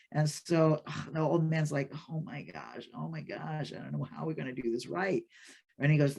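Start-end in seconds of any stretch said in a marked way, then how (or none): 2.1-5.2 hushed, worried muttering to himself; fretting old man. Acted. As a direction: fret softly, well under your usual volume. Old man frets at new criticism.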